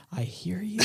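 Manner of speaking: soft, intimate whisper close to the cheek. Acted. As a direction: whisper it as if right up against someone's cheek. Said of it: "I'm whispering".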